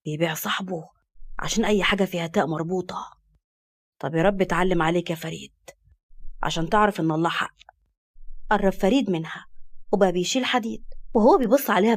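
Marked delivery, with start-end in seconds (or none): 0.1-7.6 low, soft grumbling male voice; muttered resentment. Acted. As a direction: grumble a curse at your friend. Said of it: "وهو بيقول بغيظ".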